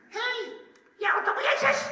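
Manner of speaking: put-on very high, piping little-girl voice. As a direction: imitate a tiny child calling someone out. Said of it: "komt er ineens een klein meisje". (hoog, piepend kleinmeisjesstemmetje)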